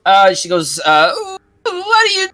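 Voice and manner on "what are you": put-on high shrill old witch cry. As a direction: snap at them like an old witch. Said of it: "like that".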